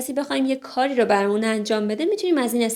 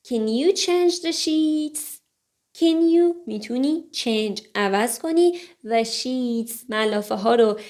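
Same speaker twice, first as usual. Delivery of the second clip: ordinary talk, nothing marked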